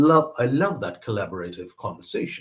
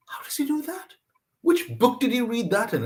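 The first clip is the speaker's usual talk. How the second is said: high-pitched incredulous wondering; amazed classmates; 12 semitones higher than usual. Retold or imitated. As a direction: marvel in a high, baffled voice.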